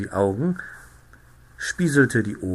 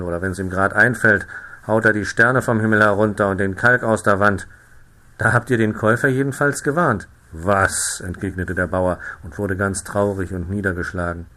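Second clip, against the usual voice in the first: plain reading voice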